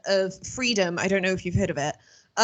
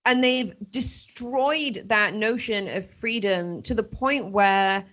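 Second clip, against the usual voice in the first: usual speaking voice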